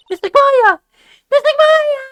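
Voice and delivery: high, loud tearful wail; crying voice. Acted. Attorney calling for his kidnapped assistant, high tearful wail. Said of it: "try with the crying voice".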